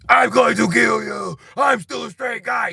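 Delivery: very high, slow shouted threat; mock furious shout. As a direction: yell it like a cartoon villain. Shouted quote in scar story.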